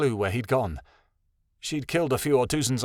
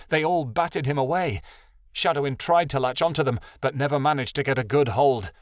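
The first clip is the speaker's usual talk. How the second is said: ordinary delivery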